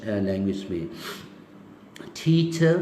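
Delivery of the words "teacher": टीचर with puffed t and ch, no final r; British टीचर, higher pitch, silent r; British pronunciation. Shown as a demonstration. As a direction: say टीचर slowly, ending in a schwa. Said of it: "उसको हमने कर दिया".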